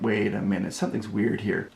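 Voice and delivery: low, hushed thinking aloud; quiet to self. Retold or imitated. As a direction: mutter it to yourself. I turn to the nurse.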